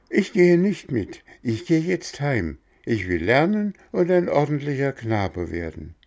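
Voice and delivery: put-on a puppet-boy standing his ground; firm boy refusal. Bengele refuses the coachman.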